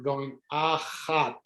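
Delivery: achat with a throaty ch from the back of the throat; guttural ch. Shown as a demonstration. To the class, teacher shows a throaty ch. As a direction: scrape the ch in achat. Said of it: "getting the ch".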